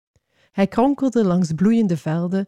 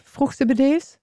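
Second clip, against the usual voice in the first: plain reading voice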